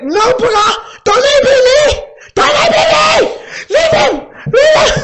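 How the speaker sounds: very high, loud, crying wail; hammed-up high sobbing shriek; mock wailing sister. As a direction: wail like a tipsy girl being dragged off. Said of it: "She's like".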